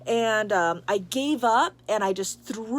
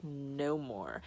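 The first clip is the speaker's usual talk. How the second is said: low, hushed, final word; very definite; 7 semitones lower, 10 dB quieter than usual. Retold or imitated. Stern presence forbidding further attempts, quietly definite. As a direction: shut it down quietly and firmly. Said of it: "like, a very definite".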